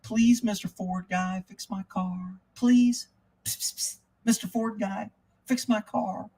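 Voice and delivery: soft, high, exaggerated little begging voice; mock pleading whisper. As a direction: beg in a sarcastic hushed voice, drawn out. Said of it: "you want me to fucking whisper?".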